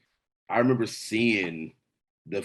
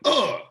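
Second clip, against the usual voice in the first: angry grunt; 11 semitones higher, 4 dB louder than usual. Retold or imitated. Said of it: "He was just like".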